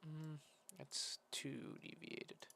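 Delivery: breath whistling through a dry nose; whistling nose on a slow whisper. Real, not acted. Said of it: "Sorry for my nose whistling".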